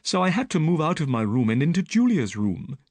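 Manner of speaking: put-on frightened young woman telling her story; Miss Stoner.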